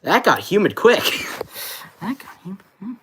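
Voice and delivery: muffled inside a helmet; talking through a mask; behind welding mask. Real, not acted. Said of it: "with this mask on".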